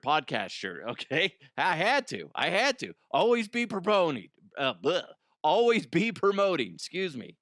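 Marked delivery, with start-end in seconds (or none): 3.1-4.3 higher, punchy salesman slogan; mock slogan. Real, not acted. Podcaster joking about wearing his own shirt, mock slogan. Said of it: "joking slogan, repeated". 5.4-6.7 higher, punchy salesman slogan; mock slogan. Real, not acted. Podcaster joking about wearing his own shirt, mock slogan. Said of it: "repeated slogan".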